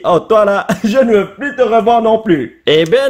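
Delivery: ordinary talk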